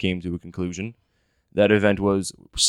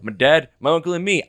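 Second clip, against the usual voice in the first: upbeat raised show intro; announcer voice; 4 semitones higher than usual. Acted. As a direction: pitch it like a show host.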